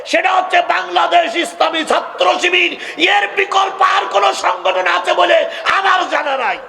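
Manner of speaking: high, ringing rally shout; full-force preaching. Real, not acted. Preacher urging parents toward the student organisation, high impassioned shout. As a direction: proclaim it to the crowd at full pitch.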